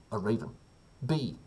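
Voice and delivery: ordinary talk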